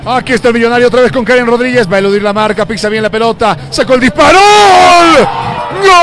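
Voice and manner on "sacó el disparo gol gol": long high goal yell; goal scream. Real, not acted. Goal scored, commentator erupts.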